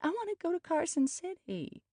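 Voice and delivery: very high-pitched whine; high, petulant pleading; whiny. A narrator's reading. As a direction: whine it like a thwarted child. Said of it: "she said in a whiny voice".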